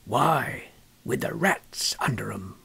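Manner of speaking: put-on an old man's secret, breathed out; hushed reveal. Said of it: "He says".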